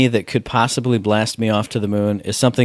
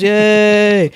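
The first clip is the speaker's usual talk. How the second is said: crowd cheer; 9 semitones higher, 4 dB louder than usual. Retold or imitated. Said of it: "everyone goes".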